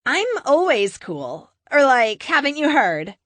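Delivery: put-on breezy Valley Girl accent, brushing off the warning. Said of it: "with her Valley Girl accent".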